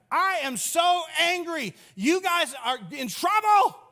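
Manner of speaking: hammed-up high voice, building to a shout; mock angry Jesus. Jesus to money changers, hammed-up angry shout. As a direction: pretend rage, silly and high. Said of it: "he's overwhelmed with anger and he's like".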